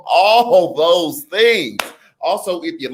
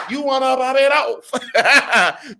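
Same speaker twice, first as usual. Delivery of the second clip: laughing; 10 semitones higher, much slower than usual. Real, not acted. Repeat, laughing.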